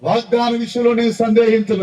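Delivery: loud, high vow; shouted declaration. Shown as a demonstration. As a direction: shout it as a confession. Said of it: "చెప్పండి అన్నమాట". (బిగ్గరగా అరిచే ప్రకటన)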